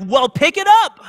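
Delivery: loud high-pitched angry order, imitated; berating. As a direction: shout it like an irate driver. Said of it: "begins to berate me".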